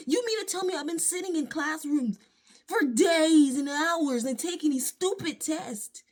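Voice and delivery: usual speaking voice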